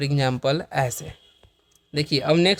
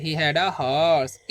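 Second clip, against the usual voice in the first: धीमा स्पष्ट उच्चारण; 2 semitones lower, much slower than usual. Shown as a demonstration. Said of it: "उसके पास एक घोड़ा था".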